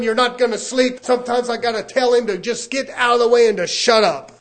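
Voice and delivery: high, loud, driving preacher cadence; full-force preaching. Real, not acted. Preacher speaking as a son of God, at full force. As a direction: build in relentless repeated phrases, nearly shouting.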